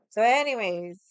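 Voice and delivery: ordinary talk, nothing marked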